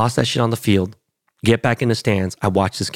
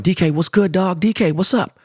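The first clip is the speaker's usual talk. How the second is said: calling out; 9 semitones higher than usual. Retold or imitated. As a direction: shout his name to get his attention over the crowd. Calling to D K across field.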